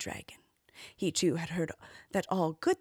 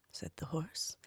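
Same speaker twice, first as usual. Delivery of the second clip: plain reading voice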